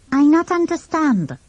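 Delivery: put-on French accent, bowing politely. A narrator's reading. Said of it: "said the boy politely".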